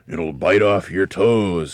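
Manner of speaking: slow, loud, drawn-out warning; ominous teasing. A narrator's reading. Mr. Twit describes the beast to frighten his wife, ominous teasing. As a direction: stretch it out menacingly.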